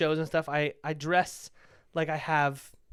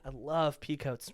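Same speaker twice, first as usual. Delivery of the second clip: slow, softer, lingering delivery; sexual; 7 dB quieter, slower than usual. Real, not acted. Comic confessing love of pea coats, sexual. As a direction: draw it out with relish, softly. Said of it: "You said that sexually".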